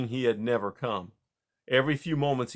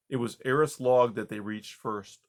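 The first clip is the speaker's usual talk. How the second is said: usual speaking voice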